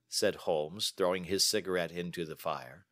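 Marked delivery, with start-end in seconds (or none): none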